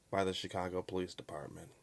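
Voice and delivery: ordinary delivery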